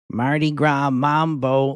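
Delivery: slow, heavy Midwestern singsong; imitated Midwestern lady singing along; Midwestern accent. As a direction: chant it in a broad Midwestern accent. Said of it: "the most Midwestern voice come out".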